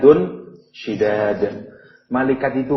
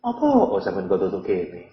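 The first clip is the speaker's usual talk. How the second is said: casual question; 3 semitones lower than usual. Retold or imitated. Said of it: "bukan sekedar pertanyaan yang".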